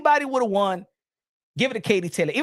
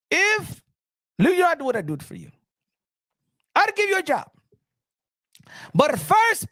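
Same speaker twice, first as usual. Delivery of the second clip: the Turk impression; 11 semitones higher than usual. The Turk confronting Punch Drunk Boxing, high and indignant. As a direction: demand it like an offended boss. Impression mocking his teeth.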